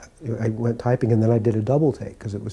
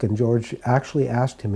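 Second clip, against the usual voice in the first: normal talking voice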